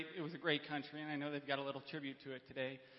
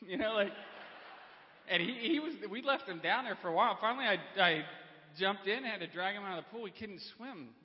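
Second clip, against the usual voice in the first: laughing; 4 semitones higher, 5 dB louder than usual. Real, not acted. Laughing retelling pool mishap.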